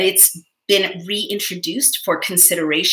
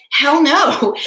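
loud and high, half-playful outburst; emphatic hell no; 8 semitones higher, 6 dB louder than usual. Retold or imitated. Speaking for the Senate, a loud mock refusal. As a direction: blurt it out like a fed-up senator.

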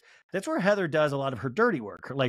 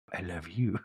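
much lower and softer take, rising at the end; Meredith impression, softer; 10 semitones lower, 7 dB quieter, slower than usual. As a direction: drop lower and quieter. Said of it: "And Meredith goes".

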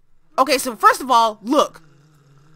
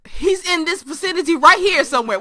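usual speaking voice

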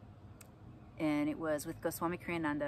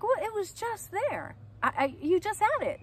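puzzled mom; 5 dB louder than usual. Retold or imitated. Mom asking son where his ball went, puzzled. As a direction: press him gently, baffled.

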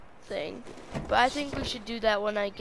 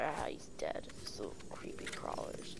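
whispering; 13 semitones lower, 15 dB quieter than usual. Real, not acted. Quiet remark after the kill.

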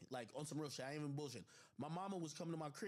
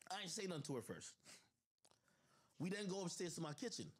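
ordinary talk, nothing marked

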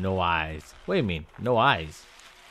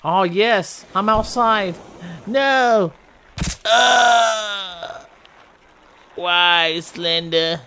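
high, loud joy turning to a wail; cheer, then cry out in despair; excited then dismayed cries; 7 semitones higher, 4 dB louder, much slower than usual. Real, not acted. Player escaping then caught again, loud dismayed cries.

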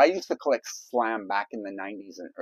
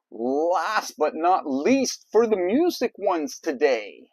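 drawn-out announcer call; 5 semitones higher, 5 dB louder, slower than usual. Acted.

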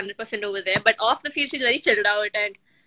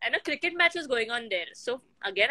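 normal talking voice

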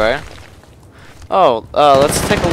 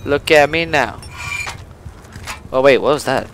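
usual speaking voice

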